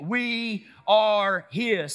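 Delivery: emphatic, each word drawn long; slow, weighty group chant; say it like you mean it. Shown as a demonstration. Preacher rousing congregation to mean it, slow and emphatic. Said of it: "say it like you mean it".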